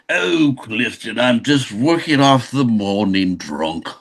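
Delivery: put-on slow, drawling tipsy posh English accent. English director answering a morning greeting, posh English drawl. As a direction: drag it out as a hungover posh English toff. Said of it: "very posh and little".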